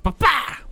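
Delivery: high, loud whip-crack noise. Shown as a demonstration. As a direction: snap it out like a whip. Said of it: "I can't make the whip sound effect".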